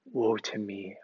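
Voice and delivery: whispered, soft lament; a hushed cry of woe. Acted. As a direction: breathe it out like a broken confession. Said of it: "I cried".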